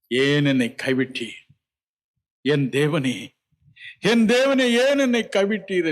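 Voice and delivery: crying out. Retold or imitated. Jesus on the cross crying out to God, building anguish. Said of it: "கதறியே தன் ஜீவனை விட்டார்".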